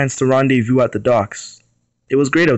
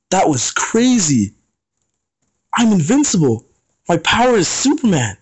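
higher, thrilled outburst; exclaiming; 7 semitones higher than usual. Acted. Cedric waking up after the crash, thrilled exclamation. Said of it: "he exclaimed".

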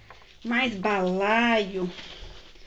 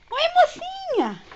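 slow, high cooing greeting; slower than usual. Real, not acted. Owner cooing at her ferret in slow high baby talk. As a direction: draw it out high and sweet.

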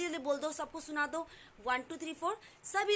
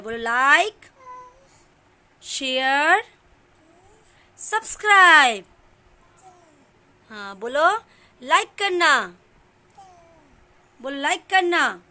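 loud coaxing; 11 dB louder than usual. Real, not acted. Coaxing toddler to say like share.

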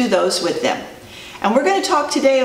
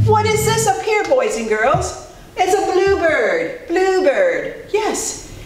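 excited; 9 semitones higher, slower than usual. Acted. Excited reveal to kids.

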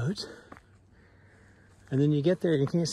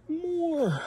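laughing; 12 semitones higher than usual. Real, not acted. More trail ahead.

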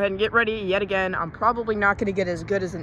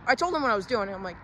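usual speaking voice

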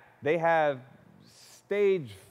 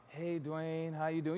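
dragged out; 8 dB quieter, slower than usual. Retold or imitated. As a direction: sound worn down and weak. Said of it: "You all dragged out, down voice".

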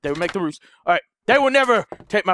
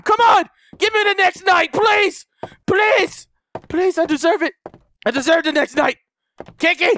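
desperate pleading shouts; 8 semitones higher than usual. Real, not acted. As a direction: plead hard with the game. Begging game for next night.

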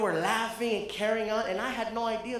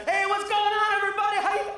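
nervous stage greeting; 11 semitones higher, 4 dB louder, faster than usual. Retold or imitated. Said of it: "And I".

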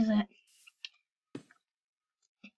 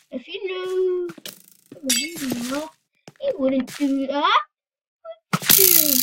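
usual speaking voice

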